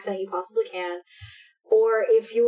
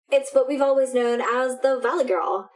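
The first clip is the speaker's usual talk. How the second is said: usual speaking voice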